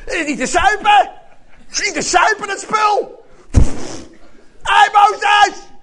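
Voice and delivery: loud, high angry shouting; hammed-up furious complaint; disgusted outrage. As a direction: yell in disgust at the leader. Acted angry crowd spitting out water.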